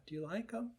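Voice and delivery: soft, coaxing invitation; gentle guiding voice. Shown as a demonstration. Teacher guiding the hurt part to the yard, soft and coaxing. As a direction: lead them softly toward something nice. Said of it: "back and forth".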